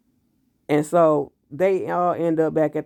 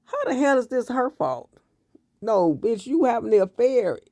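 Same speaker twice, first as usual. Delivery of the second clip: incredulous outrage; 8 semitones higher than usual. Real, not acted. Outraged at the character's blame.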